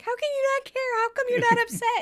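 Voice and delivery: high, worked-up mock complaint; exasperated self-imitation. Woman imitating herself nagging unbothered partner, exasperated. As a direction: pitch way up, rushing into the second question. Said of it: "I'm always like".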